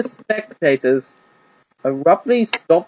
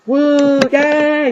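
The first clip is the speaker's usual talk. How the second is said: much higher, louder whoop; crowd cheer; 10 semitones higher, 4 dB louder than usual. Acted. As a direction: cheer from the stands. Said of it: "the crowd cheered".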